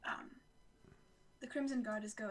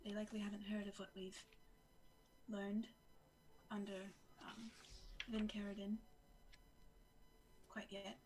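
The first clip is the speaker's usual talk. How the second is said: whispering; 3 semitones lower, 10 dB quieter than usual. Acted. Whispered aside in character.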